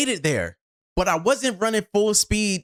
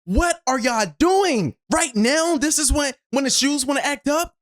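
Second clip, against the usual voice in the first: scolding the shoes; 7 semitones higher than usual. Retold or imitated. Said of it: "looking at my shoes like".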